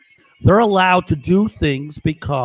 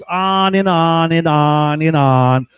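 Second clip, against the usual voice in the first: slow, stretched, droning repeats; drawn-out repetition; 4 dB louder, slower than usual. Real, not acted. Man complains about endless harassment, drawn-out repetition. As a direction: drag each repeat out, swelling at the end.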